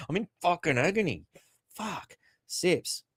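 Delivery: usual speaking voice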